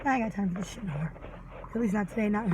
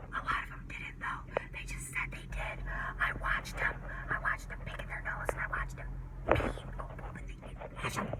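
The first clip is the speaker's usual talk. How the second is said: hushed conspiratorial whisper; 13 semitones lower, 10 dB quieter than usual. Acted. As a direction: lean in and whisper it like a secret. Conspiratorial aside about hand washing.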